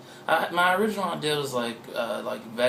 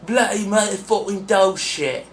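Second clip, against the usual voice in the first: slow, higher, loud cartoon Cockney; mock London accent; 6 semitones higher, 7 dB louder than usual. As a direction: put on a hammed-up East London accent. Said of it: "what's the side of London would I be with that accent".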